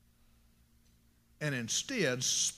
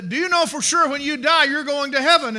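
high, loud acted question; loud witnessing; 8 semitones higher, 9 dB louder than usual. Preacher witnessing to a man out of obligation, loud question. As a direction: put the question loudly, as a retold soul winner.

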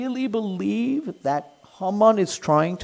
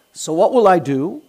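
ordinary delivery

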